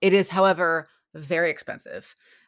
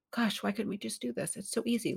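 soft wistful thought; 6 semitones higher, 10 dB quieter than usual. Retold or imitated. Inner thought retold softly.

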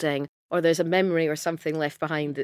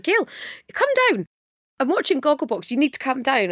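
high-pitched, insistent summons; acted urgent phone call; urgent neighbour; 6 semitones higher than usual. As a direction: imitate an excited neighbour calling someone down. Acts out neighbour phoning her urgently.

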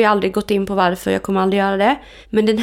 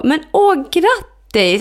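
delighted congratulations; 7 semitones higher, much slower than usual. Retold or imitated. Her ex congratulating them on their new love, high and delighted. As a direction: imitate his delighted surprise.

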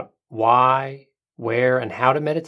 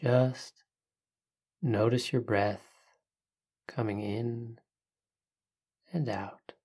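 soft spa whisper; 5 dB quieter than usual. Acted. Leads a breathing meditation.

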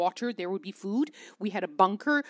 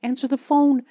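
louder, higher, impatient plea to the phone; will the line to pick up, exasperated; frantic inner call to London, half-voiced; urgent pleading; 5 semitones higher, 7 dB louder than usual. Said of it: "calling from Kyiv thinking".

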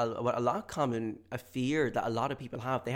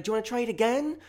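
high, raised questioning voice; loud cashier; 12 semitones higher, 6 dB louder, faster than usual. Acted. Cashier asking to retry the card, high and loud. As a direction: ask it so everyone hears. Cashier asks again, loudly.

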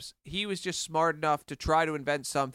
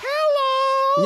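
very high and loud squeak; shrill little real voice; squeaky high voice; 10 dB louder than usual. Retold or imitated. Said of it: "maybe her real voice is just like".

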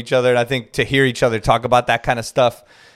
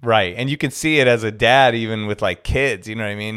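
usual speaking voice

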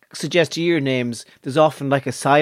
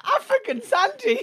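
mock high voice; slower than usual. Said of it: "African Santry is a runner".

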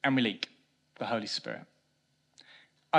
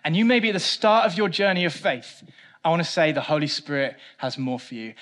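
louder, urgent appeal; fervent preaching; 4 semitones higher, 8 dB louder than usual. Acted. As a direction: lift the voice to exhort them. Preaching rising to full force.

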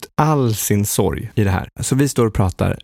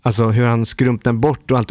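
ordinary delivery

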